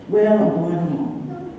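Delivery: slow, drawn-out insistence; insistent. Retold or imitated. Patient overruling a friend's objection, slowly insistent. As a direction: stretch it out stubbornly, standing your ground.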